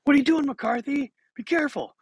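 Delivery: high-pitched mock scolding; snapping coach. Coach to the boy after a wild throw, high mock scolding. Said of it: "he snaps his head up".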